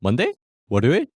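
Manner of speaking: Monday and 월요일 each swept upward at the end; rising question intonation. Shown as a demonstration. Teacher showing learners a noun question, Monday and 월요일 rising. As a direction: ask Monday, then 월요일, as one-word questions. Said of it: "That rising intonation".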